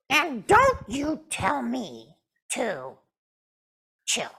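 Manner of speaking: pouting puppet. Acted. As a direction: stretch out the sulk. Puppet Jasmine warns.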